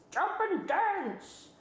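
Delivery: high, slow rooster voice. A narrator's reading. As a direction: crow it slowly. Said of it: "said the rooster".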